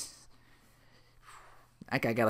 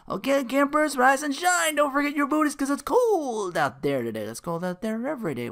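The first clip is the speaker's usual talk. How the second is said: much higher, perky morning-radio patter; 14 semitones higher, 4 dB louder than usual. Retold or imitated. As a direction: wake up the campers like a chirpy DJ. Radio DJs wake-up banter.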